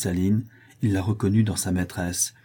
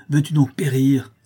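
crying out; 6 semitones higher, 6 dB louder than usual. Acted. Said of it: "s'écria Jeanne".

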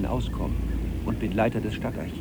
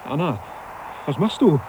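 rising alarmed call, much higher; 6 semitones higher than usual. Acted. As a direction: call her name in panic. Anna throws things overboard.

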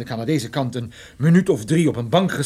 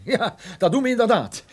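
laughing reply; 8 semitones higher than usual. Acted. Officer laughs at the question.

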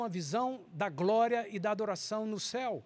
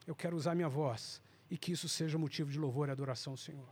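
usual speaking voice

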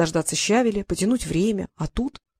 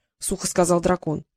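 ordinary delivery

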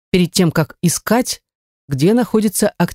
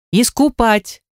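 threatening; 6 semitones higher, slower than usual. Acted. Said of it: "пригрозила я".

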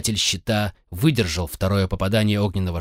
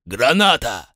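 grenade warning yell; 2 semitones higher, 4 dB louder, slower than usual. A narrator's reading. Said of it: "крикнул".

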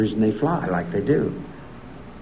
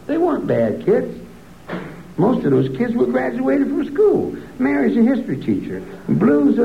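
higher, rising indignant tirade; worked-up rant; 10 semitones higher than usual. Real, not acted. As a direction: pour out the grievance, climbing as you go. Bitter rant about prison years.

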